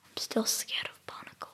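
hushed, whispery aside; whispered. Real, not acted. Child admitting fear of barnacles, whispering. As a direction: whisper it as a small confession.

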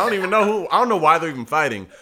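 usual speaking voice

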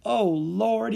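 high cry through mirth; high, hilarious exclamation; helpless amused cry. A narrator's reading. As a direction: gasp it out, overcome with amusement. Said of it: "he cried when he could finally speak".

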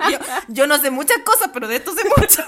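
bragging while laughing. Real, not acted. To her cohost, bragging about celebrity gossip while laughing. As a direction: let the laugh ride over the brag.

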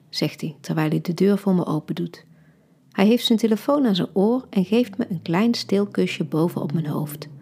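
plain reading voice

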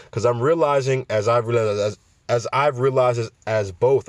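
stumbling over repeated words; stutter, restarting the phrase. Real, not acted. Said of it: "I don't know why I'm stuttering".

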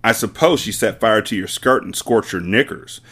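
usual speaking voice

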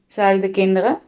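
ordinary delivery

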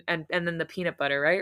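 plain reading voice

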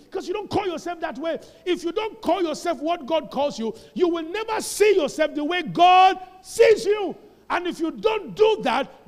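rising into a shouted, slowed sermon climax; preaching at full force. Acted. Preacher rousing the congregation, building to a shout.

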